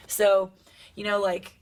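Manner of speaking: normal talking voice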